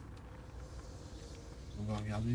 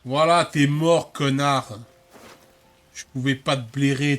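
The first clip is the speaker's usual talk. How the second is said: loud mocking taunt; gloating; 9 dB louder than usual. Real, not acted. Gloating after killing companion.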